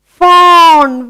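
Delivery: high, loud, drawn-out "Fawn" with open aw; modelled word. Shown as a demonstration. Teacher to children, loudly modelling "Fawn". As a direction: call out "Fawn", stretching the aw. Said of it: "A baby of deer".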